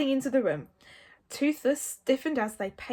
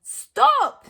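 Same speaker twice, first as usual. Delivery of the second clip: high, loud yell; sharp high-pitched shout; Hiccup yelling; 14 semitones higher, 12 dB louder than usual. A narrator's reading. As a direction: yell it out in alarm. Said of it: "yelled Hiccup".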